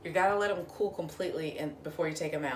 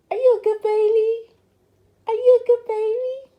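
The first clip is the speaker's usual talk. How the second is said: talking to a dog; 14 dB louder than usual. Real, not acted. Praising the dogs, baby talk.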